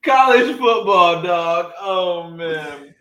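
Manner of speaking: high, loud, helpless with laughter; real laughter stretching the words out; laughing. Real, not acted. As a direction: try to move on while cracking up. Cracking up, trying to move on.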